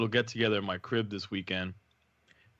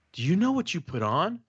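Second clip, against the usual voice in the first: higher, mock shocked teen girl; imitating teen girl; 7 semitones higher than usual. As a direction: play the older daughter asking in disbelief. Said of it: "She's like".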